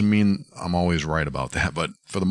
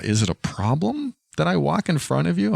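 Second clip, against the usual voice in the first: puzzled quote; 10 semitones higher than usual. Retold or imitated. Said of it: "putting words in her mouth".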